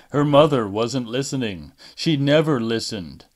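ordinary delivery

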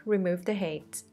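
usual speaking voice

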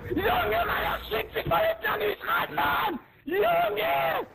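high furious shouting; outraged shriek. Real, not acted. Gamer is attacked from behind and shrieks high in fury.

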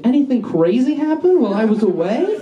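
high, singsong, fake-casual question; feigned innocence. Student returning to the classroom, feigned innocence. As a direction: ask it too brightly, rising at the end.

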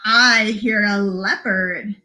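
slow, lilting story reading; picture-book read-aloud. Acted. Librarian reading Polar Bear to children, slow lilting read-aloud. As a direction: read it slowly with singsong emphasis.

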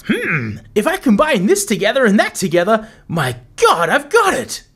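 hammed-up mad scientist, rising to a squeak; nerdy scientist voice. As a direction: play a giddy nerdy potion master. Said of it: "I apologize for the terrible voice acting".

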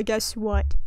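ordinary delivery

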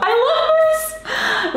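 high, slow squeal of love; squealing with delight. Real, not acted. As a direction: gush it out high and drawn. Gushing over lip shade.

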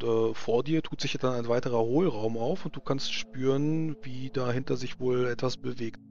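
ordinary delivery

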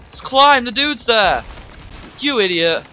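high, loud scolding of his own character; frustrated yelling. Real, not acted. Player scolding his character for looking at camera, loud yelling. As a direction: yell at yourself in frustration.

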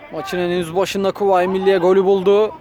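ordinary delivery

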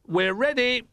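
high excited shout; an excited little bear shouting. Acted. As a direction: call out eagerly from up high. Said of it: "he shouted".

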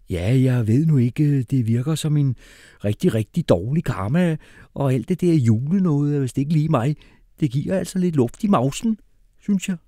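high, loud reluctant whine; squeamish doubting hippie. Acted.

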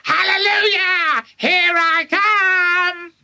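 very high, screechy cry, slow at the end; cackling crone rising up; wild old grandma. Acted. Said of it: "finding her voice at last".

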